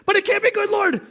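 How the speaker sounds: distressed believer; anxious protest. Pretend. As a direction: wail your protest to God. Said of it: "arguing with God".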